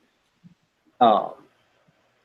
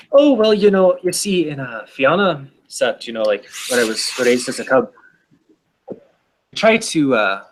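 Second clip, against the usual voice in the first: put-on bastardized Irish accent. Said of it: "a bastardized Irish accent".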